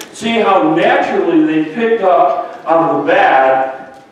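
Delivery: ordinary talk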